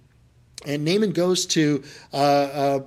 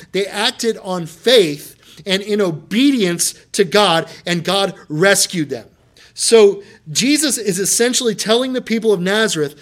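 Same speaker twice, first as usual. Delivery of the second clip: preaching at full force; 5 semitones higher, 7 dB louder than usual. Acted. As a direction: preach it to the whole room with rising fire.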